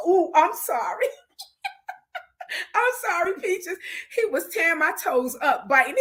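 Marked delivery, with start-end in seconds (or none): 0.1-3.8 apologizing through real laughter; laughing apology. Real, not acted. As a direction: giggle out the sorry.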